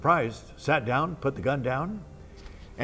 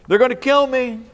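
higher and louder, panic rising on kill; panicked fear; 8 semitones higher, 8 dB louder than usual. Retold or imitated. Convict hearing police cars arrive, panicked. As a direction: blurt it out in terror.

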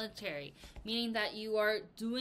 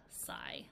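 very low, soft drawn-out sigh; dramatic sigh; 9 dB quieter than usual. Shown as a demonstration. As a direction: sigh with exaggerated disappointment. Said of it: "this was also a dramatic".

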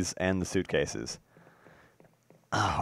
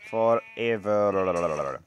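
FOREVER stretched very slowly with a rolling, wobbling tail; drawn-out ending; 2 semitones lower, much slower than usual. Pretend. Player pledging friendship to his cow, drawn-out "Forever". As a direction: drag out the end of "Forever".

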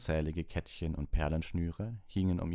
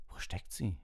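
softer, hushed question in a strange room; a boy's hushed question, held softly; 2 semitones higher, 5 dB quieter than usual. Acted. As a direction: ask under your breath so no one hears. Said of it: "Alle flüsterten".

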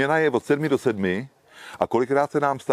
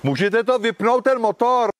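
called out high and loud, slowed; loud call to a car with its engine running; 12 semitones higher, 4 dB louder than usual. Real, not acted. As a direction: shout the request across to the driver. Calling out to a driver.

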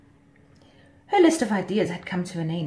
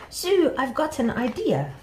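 higher, eager mosquito voice; excited announcement; 8 semitones higher, 4 dB louder than usual. A narrator's reading. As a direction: burst out with a bright idea. Mosquito jumps up with an idea.

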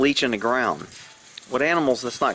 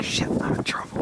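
whispered cat thought; 4 dB quieter than usual. Retold or imitated. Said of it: "He's like".

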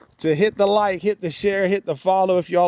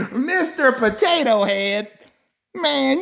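laughing; 8 semitones higher, slower than usual. Real, not acted. Laughing at the commenter's request.

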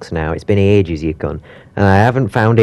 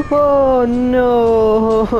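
much higher, louder frightened cry; panicked oh no; 5 dB louder than usual. Real, not acted. Player caught by the animatronic, frightened cry. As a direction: yelp it in terror. Jump scare in game.

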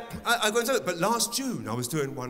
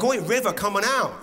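calling out; 5 semitones higher, 5 dB louder than usual. Pretend. Calling son out onstage.

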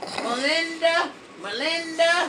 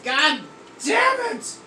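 voice breaking; 4 dB quieter than usual. Real, not acted. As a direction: let the voice break upward on the curse. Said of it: "My voice broke!".

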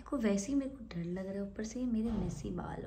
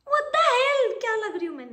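much higher, loud what the hell; real shock at the new fringe; shocked squeal; 12 dB louder than usual. Real, not acted. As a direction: shriek it in disbelief. Shocked at her reflection.

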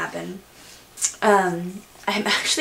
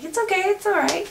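reassuring; 12 semitones higher than usual. Retold or imitated. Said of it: "we're like".

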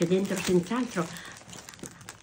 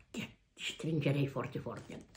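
tender; 5 semitones lower, 12 dB quieter than usual. Real, not acted. Grandma thanking grandchild tenderly.

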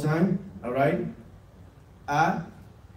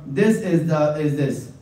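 ordinary talk, nothing marked

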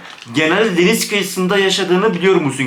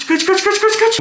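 much higher, frantic chant; panicked run cry. Real, not acted. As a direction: yell it as you flee for your life. Fleeing monster. (panik içinde kaç diye bağırma)

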